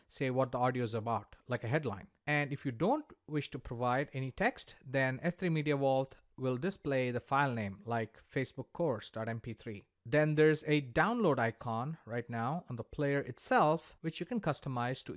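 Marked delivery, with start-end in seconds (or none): none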